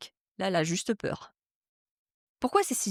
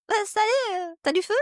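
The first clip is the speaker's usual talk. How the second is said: cracked, much higher squeaky pickup line; Mickey Mouse voice; 12 semitones higher than usual. Retold or imitated. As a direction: pitch jumps way up like a voice breaking mid-line. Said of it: "avec la voix de Mickey Mouse".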